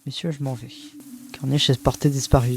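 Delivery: ordinary talk, nothing marked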